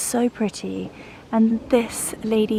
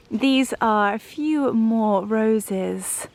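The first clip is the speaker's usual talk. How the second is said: usual speaking voice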